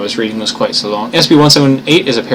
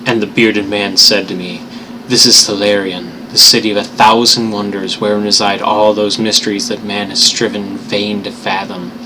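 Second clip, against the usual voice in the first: put-on measured storybook reading of old prose; story narration; 2 semitones lower, 3 dB quieter than usual. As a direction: read it like a tale by the fire. Resumes Lovecraft reading.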